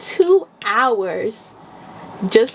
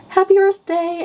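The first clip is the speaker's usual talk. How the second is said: cheery mock greeting; 11 semitones higher than usual. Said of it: "then it's like".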